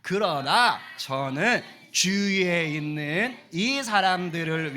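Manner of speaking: very slow, loud scripture reading; loud group reading. Shown as a demonstration. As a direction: read the verse out slowly together. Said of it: "큰 소리로".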